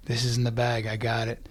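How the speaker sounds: low, soft aside to himself; private confidence. Retold or imitated. Actor thinking he won the part, low private confidence. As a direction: say it inwardly, hushed and sure.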